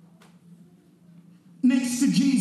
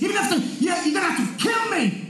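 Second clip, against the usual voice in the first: high, impassioned acted cry; hurried, high-pitched vow of loyalty; emphatic reaffirming; 5 semitones higher, faster than usual. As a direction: protest it hotly, pitched right up. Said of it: "he's just to reaffirm how he, he feels".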